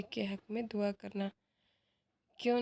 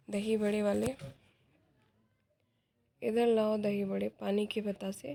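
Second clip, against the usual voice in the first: louder call to the vendor outside; 5 dB louder than usual. Acted. Shripat calls the vendor outside, loud call. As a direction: shout for the street vendor across the yard. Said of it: "ज़ोर से आवाज़ देता है".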